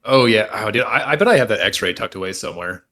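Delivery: ordinary delivery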